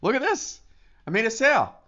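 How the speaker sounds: high, delighted exclamation; excited squeal. Retold or imitated. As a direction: gush over the phone screen. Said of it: "this is what my phone looks like".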